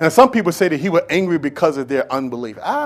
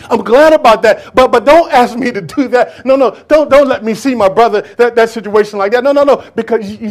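higher and louder, begging no, no, no; desperate pleading mourner; 4 semitones higher, 5 dB louder than usual. Acted. Grieving sister begging Jesus, louder and higher, not to open the tomb. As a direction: plead with rising panic against the order.

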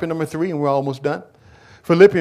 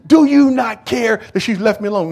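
mad Martha; 7 semitones higher, 5 dB louder than usual. Acted. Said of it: "she gets mad at".

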